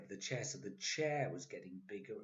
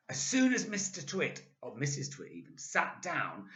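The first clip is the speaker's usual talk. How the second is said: usual speaking voice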